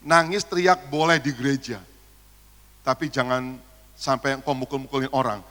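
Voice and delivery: ordinary talk, nothing marked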